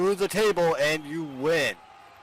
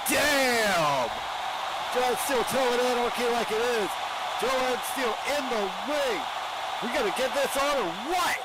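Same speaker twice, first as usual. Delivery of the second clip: hyped commentator; 9 semitones higher than usual. Real, not acted. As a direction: call the action like a ringside announcer. Excited play-by-play commentary.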